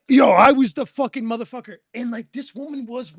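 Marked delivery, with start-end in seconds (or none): 0.1-1.8 loud, higher-pitched yell; hammed-up shouted brag; over-the-top boasting. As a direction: yell it like a hyped-up gamer. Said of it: "she wasn't even, like".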